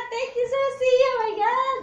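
high, emotional squeal; overwhelmed thanks. Real, not acted. Woman thanking friend for the purse, overwhelmed squeal. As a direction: thank a friend on the edge of happy tears. Moved by gift, near tears.

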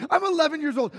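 loud, high crybaby wail; hammed-up sobbing, wailing through the words; mock bawling. Imitating himself as a crying eleven-year-old, mock bawling. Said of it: "I, I'm crying".

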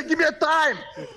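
ordinary talk

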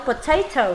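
"potato" as puh-TAY-toh; AY sound. Shown as a demonstration. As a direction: middle of "potato" rhymes with "day". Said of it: "âm A rồi".

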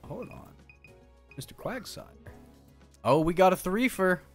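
ordinary delivery

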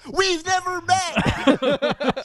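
high, slow disbelieving protest; incredulous. Real, not acted. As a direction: stretch it out in disbelief.

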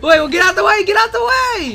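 panicked high shout. Real, not acted. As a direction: yell it in frantic panic. Yelling at teammate mid-jump.

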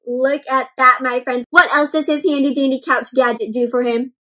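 plain reading voice

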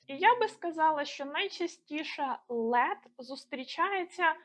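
normal talking voice

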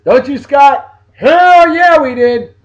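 high, loud whooping shout; rowdy excitement. Real, not acted. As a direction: holler it to a buddy. Calls out to friend about vacation.

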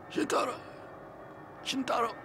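a drugged man calling feebly; faint imitated plea from the ground; barely able to speak. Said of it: "he could barely speak".

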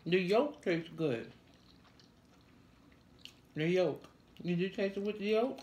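talking around food; muffled closed-mouth speech; mouth full, chewing. Real, not acted. Seen 0: Woman in red chews with cheeks full throughout the labelled words.

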